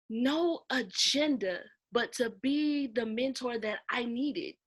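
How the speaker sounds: normal talking voice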